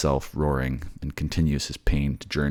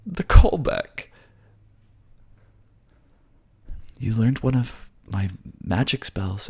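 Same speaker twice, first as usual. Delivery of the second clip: much higher voice, teasing; delighted flirtatious Juno; 8 semitones higher than usual. Acted. Juno praises the spell and asks to join the harem, teasing. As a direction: tease the hero playfully.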